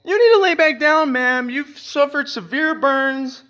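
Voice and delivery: high, slow mock medic pleading; hammed-up high nurse voice. Mock medic urging the patient, high and slow.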